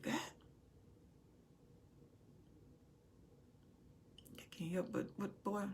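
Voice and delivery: soft, hushed loving voice of God; God's gentle whisper to his son; tender whisper. Acted. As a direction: speak lovingly, nearly whispering. God speaking tenderly to Solomon.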